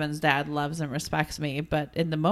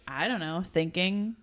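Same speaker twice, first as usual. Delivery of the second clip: shrugging mumble; 7 dB quieter than usual. Retold or imitated. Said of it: "He goes".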